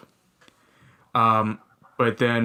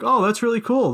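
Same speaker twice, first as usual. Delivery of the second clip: high, louder mock-eager host; mock enthusiasm; 12 semitones higher, 4 dB louder than usual. Imitating the host's mock-eager reply to a student. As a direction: fake excitement, high. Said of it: "He was like".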